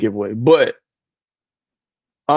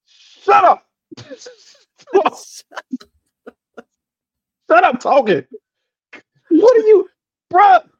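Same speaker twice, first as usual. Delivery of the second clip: exasperated outburst. Real, not acted.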